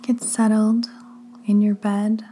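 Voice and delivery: slow, hushed delivery in short phrases with long pauses; drawn-out words, each phrase left to settle; guided sleep meditation. Acted. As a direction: lead them gently down into sleep, unhurried.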